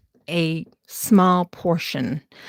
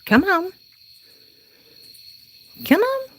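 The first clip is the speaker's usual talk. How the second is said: much higher sing-song pet call; calling the cats; 12 semitones higher than usual. Real, not acted. Calling her cats into the house at night, high sing-song.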